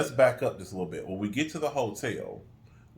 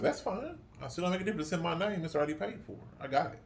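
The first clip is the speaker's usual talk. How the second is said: mock high reassurance; 8 semitones higher, 6 dB quieter than usual. Quoting himself reassuring hotel clerk.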